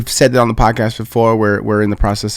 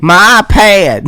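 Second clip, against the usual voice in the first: mock southern accent; 7 semitones higher, 5 dB louder, slower than usual. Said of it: "She be like".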